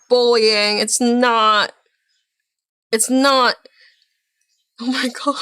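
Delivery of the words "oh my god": soft words collapsing into laughter; cracking up. Real, not acted. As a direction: lose your breath laughing. Cracks up at his discovery.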